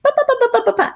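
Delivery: high, loud babbling imitation; rapid French babble. Mocking the French woman giving directions, high babble. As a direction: mimic fast foreign chatter. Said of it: "she was like".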